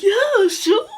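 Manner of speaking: very high, loud mock-cheery voice; imitated lady's reply. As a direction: squeal it in an exaggerated high put-on voice.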